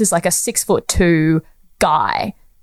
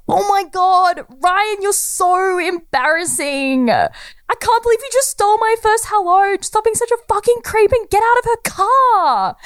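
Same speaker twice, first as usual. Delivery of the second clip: very high, mortified mock yell; hammed-up girl yelling, voice pitched way up; retold yell; 14 semitones higher than usual. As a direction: scold an embarrassing Ryan in a high-pitched holler. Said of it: "this girl just yells".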